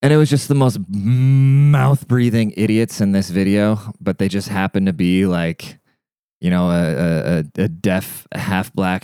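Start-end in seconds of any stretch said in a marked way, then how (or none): none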